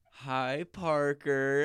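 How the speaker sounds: loud, high, drawn-out imitated flirt; mock flirty greeting. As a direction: call the name out like a tipsy admirer.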